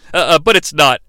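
usual speaking voice

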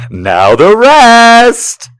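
drawn-out, loud hammy come-on; seductive tease. Retold or imitated. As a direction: ham up the seductive line. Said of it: "wiggles his ass at her and goes".

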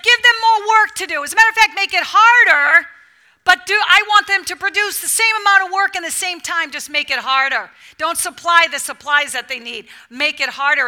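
loud, high tyrant's orders; commanding Pharaoh. Acted. Pharaoh ordering harder work for the people, loud and harsh. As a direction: bark the demands like a harsh king.

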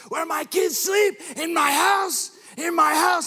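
high, loud furious scream. Retold or imitated. Mobster confronting the traitor, loud mock scream. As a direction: scream it like a betrayed mob boss. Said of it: "he begins to scream this".

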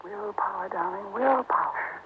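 soft, drawn-out aside; hushed prompting. Acted. Wife coaching husband during his phone call, hushed. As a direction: urge him on under your breath.

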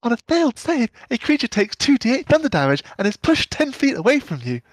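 put-on high little girl voice. Shown as a demonstration. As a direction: read it like a small girl. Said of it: "little girl".

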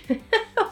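a sudden high burst of laughter; real laugh. Real, not acted. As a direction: laugh at yourself mid-sentence. Laughing at her own low tolerance.

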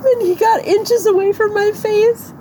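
real tearful, very high-pitched voice; crying. Real, not acted. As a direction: struggle to get the story out through sobs. Crying as she recounts the attack.

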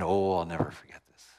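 normal talking voice

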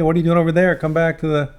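usual speaking voice

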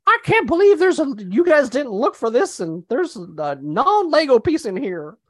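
put-on outraged complainer in a high country accent; loud, high, put-on country accent, slowing at the end. Imagined Lego buyer complaining about a fake piece, hammed-up country accent. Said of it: "gave him a country accent".

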